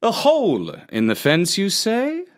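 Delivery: interested fox. Acted. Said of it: "asked Mister Fox".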